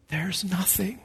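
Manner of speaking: breathy whispered emphasis; hushed whisper. Real, not acted. Preacher whispering to the congregation. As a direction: drop to a whisper to drive it home. Preacher stresses God's love.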